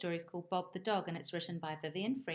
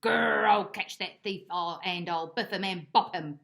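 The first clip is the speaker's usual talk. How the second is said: loud growl that cools down; a dog working himself up then simmering; growled threat; 9 dB louder than usual. Acted. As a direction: growl it hard, then trail into grumbling. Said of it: "growled Bob".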